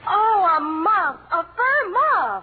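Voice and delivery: high, slow, tearful delight; touched delight. Acted. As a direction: gush over the gift, near tears. Wife unwraps the gift.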